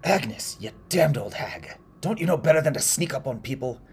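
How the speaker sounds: loud, high, irritated outburst; startled scolding. Acted. Startled woodcutter to the old woman sneaking up, scolding loudly. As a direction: snap at someone who crept up on you.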